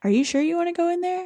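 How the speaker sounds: high, quick questioning voice; imitated wary cops. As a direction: play a doubtful cop, hammed-up. Mimics wary cops questioning her.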